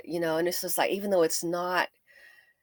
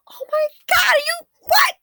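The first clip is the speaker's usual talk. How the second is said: much higher, louder cry; shocked outcry; 8 dB louder than usual. Real, not acted. Vlogger crying out at friends' indifference. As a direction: cry out in real disbelief.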